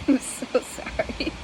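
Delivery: breathless, mostly voiceless giggle; real laughter squeezing the words out slowly; laughing apology. Real, not acted. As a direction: apologize through helpless laughter. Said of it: "I'm laughing at".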